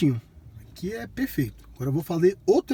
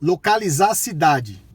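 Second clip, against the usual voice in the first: loud, slow, word by word; talking to voice assistant; 4 dB louder, slower than usual. Real, not acted. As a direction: dictate it clearly to the car.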